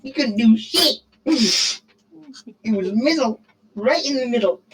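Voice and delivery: very high, laughing squeal, slowing at the end; laughing mockery. Teasing his friend over a stalemate, a high laughing squeal. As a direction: giggle it out high like you can't stop.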